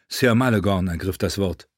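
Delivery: usual speaking voice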